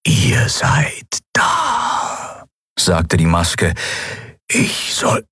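slow, breathless halting whisper that stumbles; a ruined machine groping for its lost task; whispering broken machine mask. Acted. Maske stammelt, geflüstert. (stockend flüsternde Maske, verwirrt)

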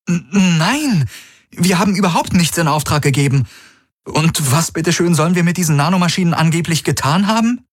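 high, fast, flustered denial; Kirai indignada. A narrator's reading. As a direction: protest your innocence in a high, rushed voice.

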